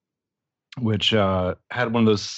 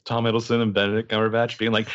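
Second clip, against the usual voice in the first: normal talking voice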